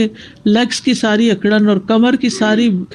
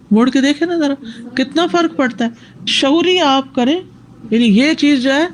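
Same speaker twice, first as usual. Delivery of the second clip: usual speaking voice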